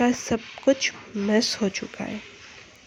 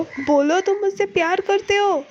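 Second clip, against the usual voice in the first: much higher desperate tearful plea; desperate cry; 10 semitones higher than usual. A narrator's reading. She begs him one last time, crying out. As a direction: break into a high desperate cry on करते. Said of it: "एकदम रोते हुए कहती है".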